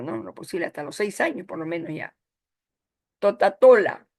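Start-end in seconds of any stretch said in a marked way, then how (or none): 3.2-4.1 put-on louder childlike totatola for Coca-Cola; mock baby talk totatola; toddler mispronunciation. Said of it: "En lugar de decir Coca-Cola, dicen totatola".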